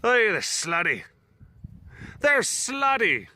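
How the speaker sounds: put-on Irish accent, pitch swooping down at the ends. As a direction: play it really Irish. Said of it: "if you were, like, really Irish".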